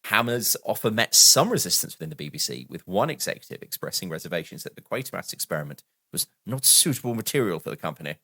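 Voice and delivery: ordinary talk, nothing marked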